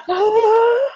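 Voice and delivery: high sharp gasp of disbelief; a loud, high, delighted gasp; starstruck gasp. Real, not acted. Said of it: "I can barely talk".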